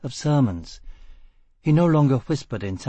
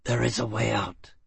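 croak; 4 dB quieter than usual. A narrator's reading. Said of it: "he croaked".

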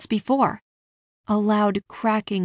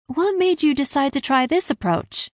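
higher-pitched, abrupt question; 7 semitones higher than usual. A narrator's reading. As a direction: put the question out of the blue. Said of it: "Ella asked abruptly".

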